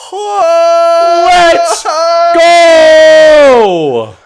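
long, high, loud holler; celebratory shout. Real, not acted. Co-host cheering the engagement, drawn-out shout. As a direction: stretch it out in a huge whooping yell.

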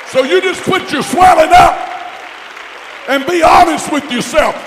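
very high, very loud pulpit shout; full preaching shout. Real, not acted. Preacher rebuking backsliders, shouting at full force. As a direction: roar it at the church.

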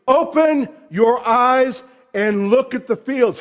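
"Open your eyes and look at the fields" called out loud and slow, like an exclamation point; exclaimed command. Shown as a demonstration. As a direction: proclaim it slowly with force, every word landing. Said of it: "there's an exclamation point".

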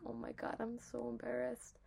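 quiet embarrassed aside to yourself; embarrassed murmur. Retold or imitated. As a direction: mumble it into your collar, mortified. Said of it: "I'm like".

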